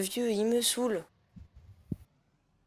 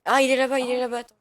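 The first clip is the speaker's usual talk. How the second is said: higher, louder excited call; excited spotting; 7 semitones higher, 5 dB louder than usual. Acted. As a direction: shout that you've found him. Spotting the old man.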